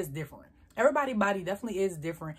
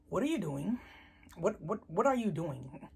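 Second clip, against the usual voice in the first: mama's hushed dismay; 2 semitones lower, 6 dB quieter than usual. Retold or imitated. Mama questions daughter about cheap deodorant, quietly dismayed. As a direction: imitate a mother quietly baffled.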